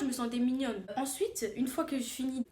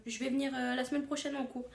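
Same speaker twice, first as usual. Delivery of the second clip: ordinary delivery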